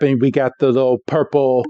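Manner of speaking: ordinary talk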